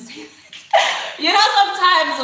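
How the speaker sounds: loud, high, breaking into laughter; laughing through words. Real, not acted. Vlogger laughing at their own boasting, words tumbling through laughter. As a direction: crack up mid-sentence and keep talking.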